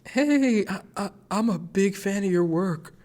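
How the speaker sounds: shy fan squeaking out a compliment; nervous greeting. Retold or imitated. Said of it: "And then I go".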